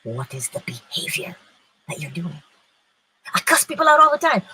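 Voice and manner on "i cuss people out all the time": loud, fast blurted confession; mock confession. Preacher voicing a listener confessing, loud and fast. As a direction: blurt it out like a hothead admitting it.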